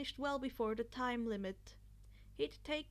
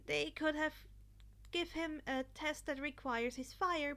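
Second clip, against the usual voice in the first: ordinary delivery, nothing marked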